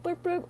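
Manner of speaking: high-pitched horn toots; quick high beeps; toot imitation. Shown as a demonstration. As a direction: mimic a little horn tooting. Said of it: "It means, like, honk".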